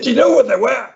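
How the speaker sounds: hammed-up Irish farmer grumbling about planes. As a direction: rattle on like an indignant Irish farmer. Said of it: "we can speak in an Irish accent".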